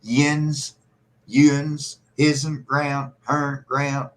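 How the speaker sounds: Appalachian dialect. Shown as a demonstration. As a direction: drawl the old Appalachian words slowly. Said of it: "sounds like old Scottish Irish".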